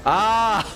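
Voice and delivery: high, loud "ah"; sudden exclamation. Real, not acted. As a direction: burst out with a high, loud cry. Sudden exclamation reading a comment.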